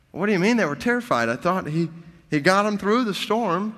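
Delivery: questioning why the disciples were terrified; puzzled objection. Acted. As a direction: push back in mock confusion. Voicing the listener's puzzled objection.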